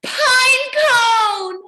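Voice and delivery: high, loud and bright, calling out a friend's name; excited character call. Acted. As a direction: shout it like a happy reunion. Character calls out in story.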